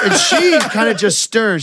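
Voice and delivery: ordinary talk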